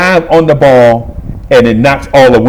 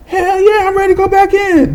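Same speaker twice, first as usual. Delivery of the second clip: much higher, pumped-up answer; eager yes. Retold or imitated. Boy telling the coach he's ready, high and eager. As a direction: jump at the chance, high and eager.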